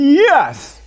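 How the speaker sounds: high, loud, drawn-out cry of joy; big triumphant shout; jubilant retold yes. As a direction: exult as if a prayer was answered. Retells his joy at Trump's announcement.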